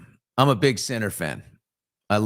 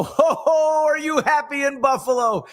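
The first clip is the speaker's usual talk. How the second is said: high, loud laughing hoot; excited greeting; 3 dB louder than usual. Real, not acted. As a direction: whoop it up at a fan. Excited shout-out to a viewer.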